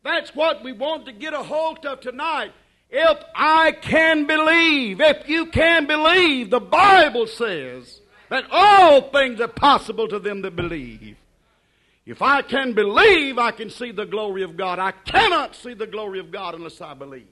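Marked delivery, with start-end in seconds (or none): 2.9-13.4 fiery preaching. Acted. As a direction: lift the congregation with it. Preaching at full force.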